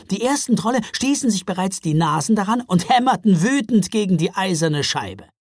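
plain reading voice

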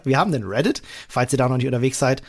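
ordinary talk

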